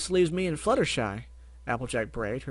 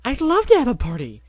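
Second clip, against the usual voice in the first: much higher, loud, thrilled; exclaiming; 12 semitones higher, 12 dB louder than usual. Acted. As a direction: shout it with pure joy. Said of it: "Pinkie exclaimed".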